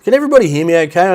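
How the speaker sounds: ordinary talk, nothing marked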